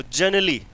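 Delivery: दूसरे तरीके से generally; pronunciation variant. Shown as a demonstration. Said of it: "generally बोला चाहे generally बोला".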